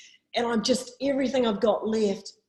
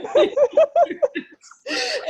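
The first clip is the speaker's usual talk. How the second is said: loud high laugh near tears; high laughing fit; 9 dB louder than usual. Real, not acted. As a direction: laugh till it almost turns to crying. Laughing at the memory.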